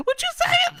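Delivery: absurdly high squeaky mock outrage; Booker, enraged. Imitating an enraged wrestler answering his rival, squeaky mock outrage. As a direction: squeal it in a falsetto caricature.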